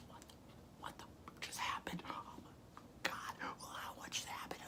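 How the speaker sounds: hushed, stunned whisper; shocked whisper. Retold or imitated. Retold shock right after the injury.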